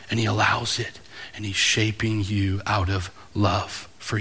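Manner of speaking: soft, low, intimate sermon voice, slowing down; tender pastor bringing the room down to a near whisper; hushed preaching. Acted. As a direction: lean in and preach it quietly, almost confiding.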